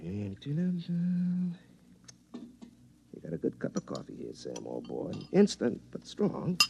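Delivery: a man chatting to himself alone in his kitchen; muttering to himself. Shown as a demonstration. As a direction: talk to yourself half under your breath. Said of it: "like this".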